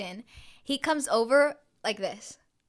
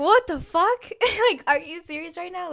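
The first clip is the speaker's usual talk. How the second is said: loud, high-pitched incredulous reaction; retold outraged astonishment; shocked disbelief; 6 semitones higher, 4 dB louder than usual.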